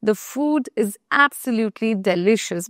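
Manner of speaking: slow, stressing absolutely; slow example sentence. Shown as a demonstration. Teacher reading a strong adjective example, slow. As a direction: say it slowly for learners. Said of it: "আমরা বলবো".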